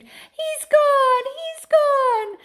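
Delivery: very high, loud shrieked cry; screaming. A narrator's reading. Mother screams as her son vanishes. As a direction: shriek in horror. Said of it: "and she screamed".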